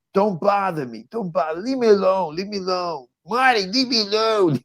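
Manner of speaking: a lightly done Philly brush-off; mild mock Philadelphia accent, pushing her son away. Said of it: "that Philadelphia accent".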